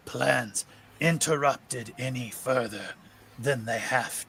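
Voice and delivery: a patient villain laying out his plan; sinister creature. Acted.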